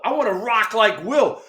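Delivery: lightly voiced teammate insisting. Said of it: "Kevin Mitchell goes up to Dusty and goes".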